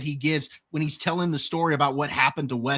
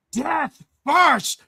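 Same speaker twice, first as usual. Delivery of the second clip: hammed-up defiance; 10 semitones higher, 5 dB louder than usual. Wesley defiant quote.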